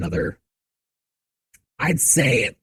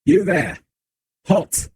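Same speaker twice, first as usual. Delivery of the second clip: guard barking an order, higher and louder; guard's command; 9 semitones higher, 4 dB louder, slower than usual. Acted. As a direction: halt them like a stern guard. Guard stops the carriage.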